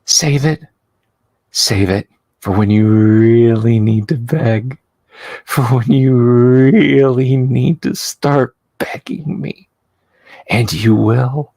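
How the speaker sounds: menacing madwoman. Acted. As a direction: stretch out REALLY to taunt the captive. Madwoman menaces her begging captive.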